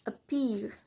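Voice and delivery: "appear" said softly, stressed on "-pear"; second-syllable stress. Shown as a demonstration. Teacher repeats the example, softly stressing "-pear" in "appear". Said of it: "stress in the second syllable".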